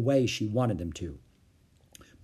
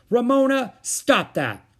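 high, loud scolding shout; sharp reprimand; 12 semitones higher, 8 dB louder, slower than usual. A narrator's reading. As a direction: call out sharply to halt a child. Mother intervenes in tantrum.

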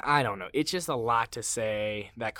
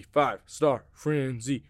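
announcer voice; 6 dB louder, slower than usual. Acted. Announcing segment title.

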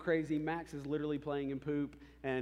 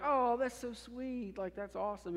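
touched parents; 8 semitones higher than usual. Retold or imitated. Parents' reaction to daughter's story.

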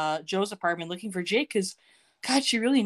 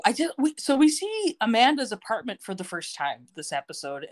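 normal talking voice

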